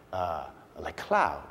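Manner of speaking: dreamy. Pretend. Mock poetic colour name.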